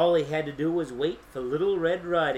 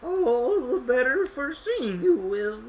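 high, slow, wobbling fake granny; wobbly voice; 7 semitones higher, slower than usual. A narrator's reading. Wolf posing as Grandma, wobbly. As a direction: quaver slowly like a pretend grandmother. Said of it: "in the same wobbly voice".

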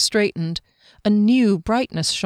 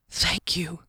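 hushed, whispered thanks; overcome whisper; 7 dB quieter than usual. Acted. Swordsman receiving a treasured gift, overcome whisper.

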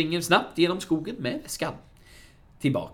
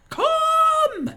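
very high, long, loud yell; screaming; 7 dB louder than usual. Acted. Sickan spotting Vanheden in the film retelling, screaming. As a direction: scream the word out and hold it. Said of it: "Då får Sickan syn på Vanheden och skriker".

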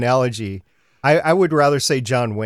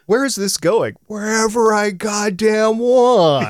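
higher, slow, rasped quote; gravelly diplomat; 11 semitones higher, 4 dB louder than usual. Retold or imitated. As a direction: growl it out like a tough diplomat. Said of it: "when she's interrogating Draper".

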